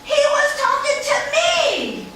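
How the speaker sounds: put-on high, loud, eager little girl voice; a proud, excited child talking fast; eight-year-old girl.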